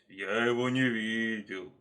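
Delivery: slow, lumbering bear voice; heavy, drawn-out delivery. Acted. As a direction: answer ponderously, drawing words out. Bear answering the leopard.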